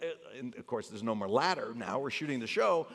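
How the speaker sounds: ordinary talk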